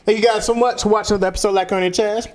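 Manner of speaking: high-pitched, quick show outro; energetic podcast closer; upbeat sign-off. Acted. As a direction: rattle off a peppy host sign-off. Upbeat first take of show outro.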